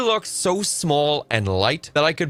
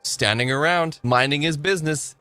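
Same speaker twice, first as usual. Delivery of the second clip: ordinary delivery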